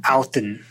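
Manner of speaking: Alton, heavier stress on the end; Icelandic Odin. Shown as a demonstration. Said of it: "more like".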